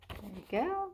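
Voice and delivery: usual speaking voice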